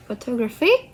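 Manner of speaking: "photographic" with the stress moved to "gra". Shown as a demonstration. Showing the shift from photograph, "photographic" stressed on "gra". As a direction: say "photographic", leaning on the third syllable. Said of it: "the stress shift to gra".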